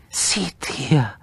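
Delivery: voiceless whisper, falling at the end. Acted. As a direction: whisper it in the dark. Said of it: "said his wife".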